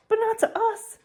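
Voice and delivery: high, whiny exasperation; exasperated. Acted. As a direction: throw up your hands at him. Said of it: "said Ron in exasperation".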